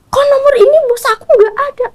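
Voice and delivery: high, sharp questioning of the husband; retelling husband's accusing question; husband's angry question. Retold or imitated. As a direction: voice him demanding where the number went. Husband checks phone, gets angry.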